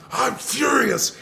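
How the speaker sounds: retold furious shout, drawn out; furious partner. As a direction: imitate a partner going apeshit. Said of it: "goes apeshit".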